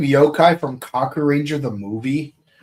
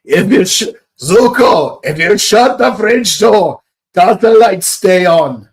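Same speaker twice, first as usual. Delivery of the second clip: mock wise Iroh; 4 semitones higher, 7 dB louder than usual. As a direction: ask it like a riddle from a wise old master. Mock Iroh asking Zuko.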